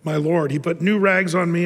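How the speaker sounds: ordinary talk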